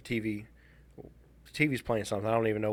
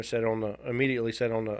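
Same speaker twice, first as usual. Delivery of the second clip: normal talking voice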